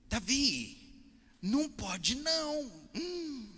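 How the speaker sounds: high, loud, slow sing-song scold; scolding church lady. Retold or imitated. Church sister scolding Davi at a party, high and drawn out. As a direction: drag out the no like a reproving auntie.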